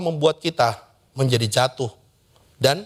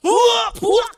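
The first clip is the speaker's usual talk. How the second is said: hammed-up fuming "wah, wah", much higher; mock angry outburst; 4 dB louder than usual. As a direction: pretend God blowing up in a rage. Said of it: "Jadi dia bukan". (letupan marah pura-pura, jauh lebih tinggi)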